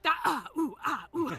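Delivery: loud, high grunts of exertion; mock combat grunting; fight effort noises. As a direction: imitate superhero punches landing, untoony. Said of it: "superhero fights with effort noises".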